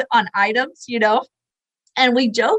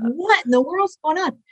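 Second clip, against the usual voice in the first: retold bafflement; 7 semitones higher than usual. Her baffled thought, acted out.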